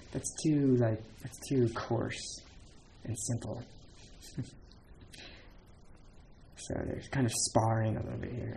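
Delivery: ordinary talk, nothing marked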